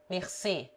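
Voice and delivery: "mercê" with a closed final e; closed, stressed e closing "mercê"; close e. Shown as a demonstration. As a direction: end "mercê" softly on a tight "ay" without glide. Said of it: "open and close sounds".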